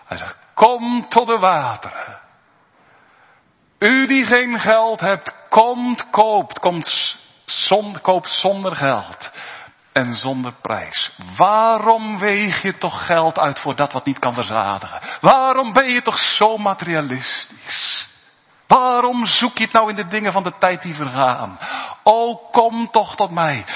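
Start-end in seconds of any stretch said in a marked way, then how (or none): 0.5-11.2 luid, verheven preekstem; vurig preken. Acted. Preaching Isaiah quote. 11.4-23.6 luide, smekende preekstem; vurig preken. Acted. Pleading rebuke in sermon.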